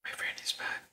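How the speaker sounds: breathy stage whisper, no voice. Real, not acted. Live on stream, whispering to the viewers. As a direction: hush it right down to a whisper. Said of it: "why are we whispering".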